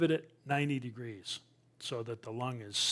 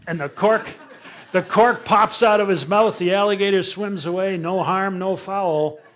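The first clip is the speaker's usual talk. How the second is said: louder, higher, laughing through it; laughing excitedly; 6 semitones higher, 12 dB louder than usual. Real, not acted. Telling friends how the gator escaped, laughing. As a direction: burst out laughing as you tell it.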